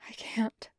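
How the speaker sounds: breathless, voiceless gasp of refusal; starving vampire gasping his refusal to the wounded commander, in a whisper. Acted. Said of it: "he gasped out".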